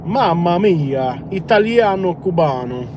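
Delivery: slow, drawn-out cartoon Italian accent; mock Italian. Driver jokes in cartoon Italian, slow mock accent. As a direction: stretch out mamma mia like a pizza ad.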